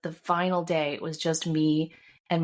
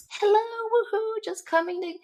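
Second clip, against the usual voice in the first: chirpy realtor. Retold or imitated. Realtor lets herself into the empty house, high chirpy call. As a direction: imitate a realtor calling out on entering. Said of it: "the realtor comes in and she's like".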